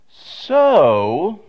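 long drawn "So" with a dip and rise; stretched out so. Retold or imitated. Wife starts to get his attention, a drawn-out "So". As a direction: drag "So" out as a warning. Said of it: "stretched out like that".

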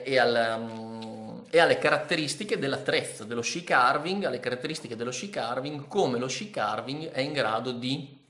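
normal talking voice

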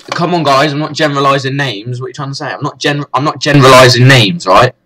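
heated self-defence; getting angry. Real, not acted. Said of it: "I got a bit angry there".

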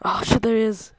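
normal talking voice

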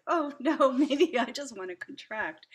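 a laughing, half-hearted alarm at the crowd; playful retold panic, laughing; mock panic. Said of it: "I was like".

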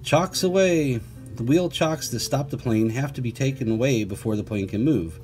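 ordinary delivery, nothing marked

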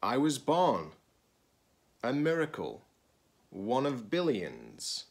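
expressive picture-book read-aloud; reading to children. Acted. Teacher reading a picture book to students, expressive read-aloud. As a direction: read it slowly to a class of children.

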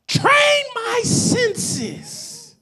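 high, loud cry to the congregation, slowing hugely at the end; full-force preaching. Real, not acted. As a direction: blast out the question, stretching every final word.

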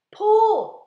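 sudden high, very loud shout. A narrator's reading. James signalling the pullers in the tunnel, shouting. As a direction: yell the command with full force. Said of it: "shouted James".

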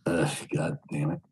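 whispered groan of annoyance; exasperated whisper. Real, not acted. Streamer alone between callers, whispering in disgust. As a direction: breathe it out under your breath, fed up.

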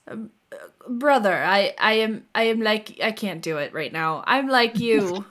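a halting, hammed-up Russian accent; bad Russian accent, hesitant and searching; mock Russian accent. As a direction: fake Russian, fumbling for words. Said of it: "I'll say that in Russian".

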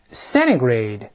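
"centigrade" said quickly, T swallowed, sounding "cenigrade". Shown as a demonstration. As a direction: run "centigrade" together, dropping the T. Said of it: "我们再快一点读". (快读"centigrade"，中间的T吞掉)

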